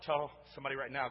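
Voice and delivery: normal talking voice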